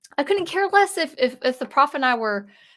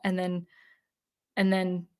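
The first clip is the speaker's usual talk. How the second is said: ordinary delivery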